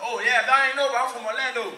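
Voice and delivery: usual speaking voice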